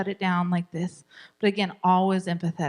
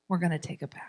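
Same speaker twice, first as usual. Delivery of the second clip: soft whispered firm parent; 4 semitones lower, 7 dB quieter, much faster than usual. Shown as a demonstration. Parent quietly insisting on the bath, whispered. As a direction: whisper the boundary kindly.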